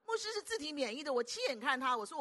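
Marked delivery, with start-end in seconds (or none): none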